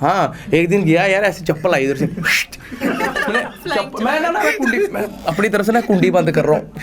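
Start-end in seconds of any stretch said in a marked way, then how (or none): 2.1-6.7 words broken by real laughter; telling the slipper story while laughing. Real, not acted. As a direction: laugh through the memory of the thrown slipper. Laughing retelling the slipper incident.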